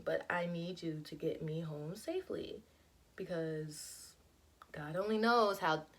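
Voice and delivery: hushed, low plea to God; soft prayer. Retold or imitated. Praying in the car for a safe drive home, hushed. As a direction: murmur it like a quick prayer.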